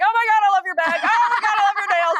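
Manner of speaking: high-pitched, over-the-top gush; gushing compliments. Retold or imitated. Traveler back home complimenting everyone, gushing. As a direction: squeal compliments as if bursting with them.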